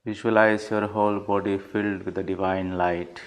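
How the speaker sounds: measured, even instructions with long pauses; unhurried meditation leader, leaving space after each phrase; guided meditation. Real, not acted. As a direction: guide them through the body, a few words at a time.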